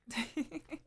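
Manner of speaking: quick high amused laugh; a short bright laugh; light laugh. Acted. Mother laughing lightly when the guest declines.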